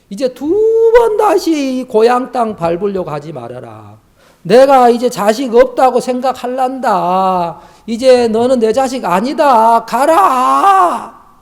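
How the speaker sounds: high, loud retold outcry, building to a long-drawn shout; angry, tearful mother. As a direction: wail it at the son like a mother disowning him. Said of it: "막 화를 내시고 울면서 막 그렇게". (화내고 울부짖는 어머니처럼 높고 크게)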